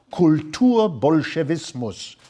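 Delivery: Kulturbolschewismus said the German way, slowed; German word. Shown as a demonstration. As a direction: give Kulturbolschewismus a native German pronunciation, unhurried. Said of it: "in German".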